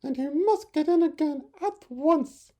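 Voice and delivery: very high, loud fretting; anxious mother bird. Acted. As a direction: scold like a worried mother bird. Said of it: "cried his mother".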